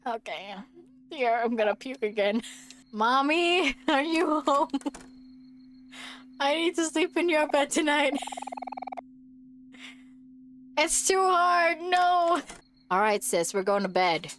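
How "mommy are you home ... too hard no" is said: put-on higher childlike whimper, slow at the end; scared little kid. Kid calling mommy to sleep in her bed, higher childlike. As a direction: plead like a small frightened child.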